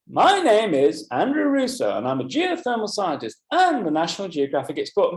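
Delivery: exaggerated swooping tune on each phrase, following the hum; N Andres-style intonation. Shown as a demonstration. Teacher models how students could copy the speaker, exaggerated intonation. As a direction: lift MY and AND with big pitch swings. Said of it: "have a go at sounding like Andres".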